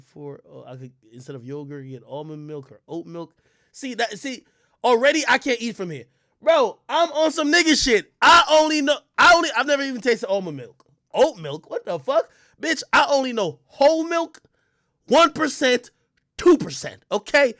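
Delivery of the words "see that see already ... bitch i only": raised, louder, higher voice; real worked-up rant; loud indignant rant. Real, not acted. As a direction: go off about the menu, heated. Ranting about the menu.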